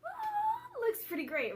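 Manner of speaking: a high, loud, happy "ah"; thrilled by the food; delighted. Real, not acted. First bite of pizza.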